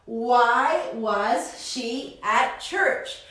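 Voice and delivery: slow, clear question with rising end; slow teaching read. Shown as a demonstration. Teacher models the question slowly for the class, slow rising. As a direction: spell it out slowly for students.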